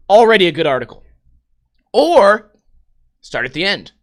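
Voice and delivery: ordinary delivery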